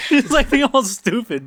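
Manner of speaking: high-pitched laughing speech. Real, not acted. Teller amused by Katoshi's antics, laughing. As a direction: crack up while telling it.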